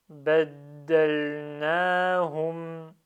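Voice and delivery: "Baddalnahum" very slow and loud, long a after n; emphasised prolongations. Shown as a demonstration. Teacher reads in one go, "Baddalnahum" with prolongation stressed. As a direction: stretch the "na" in "Baddalnahum". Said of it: "J'essaie de souligner les prolongements".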